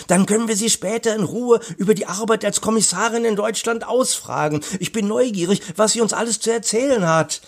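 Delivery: put-on high, fast boy's character voice; eager boy. A narrator's reading. Boy plans to question the commissioner, eager.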